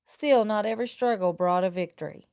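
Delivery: ordinary delivery, nothing marked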